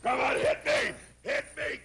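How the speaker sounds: ordinary delivery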